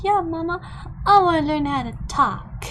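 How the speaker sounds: lightly voiced toddler begging to learn. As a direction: chirp it like an eager toddler. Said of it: "She's like".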